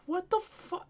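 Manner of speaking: high, stunned "what the fuck"; shocked. Retold or imitated. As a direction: let it fly up high in disbelief. Appalled by caller's story.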